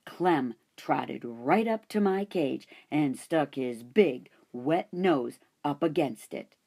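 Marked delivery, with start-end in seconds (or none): none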